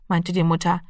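usual speaking voice

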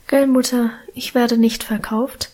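plain reading voice

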